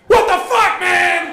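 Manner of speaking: very high, loud outraged squeal; shocked shriek. Retold or imitated. Jordan receiving the photo, high loud shocked squeal. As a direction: squeal it in disgust. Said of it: "I get this message back".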